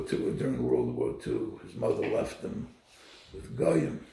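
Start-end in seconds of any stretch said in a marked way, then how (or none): none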